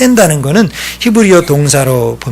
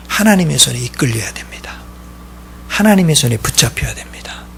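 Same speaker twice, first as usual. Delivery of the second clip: ordinary talk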